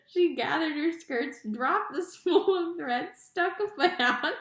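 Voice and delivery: narration fighting off giggles; reading while cracking up; laughing. Real, not acted. Reader losing it at the clumsy maid, laughing. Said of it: "sorry, I'm visual".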